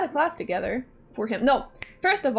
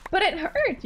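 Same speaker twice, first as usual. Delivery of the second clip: voice crack; 8 semitones higher than usual. Real, not acted. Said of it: "voice crack".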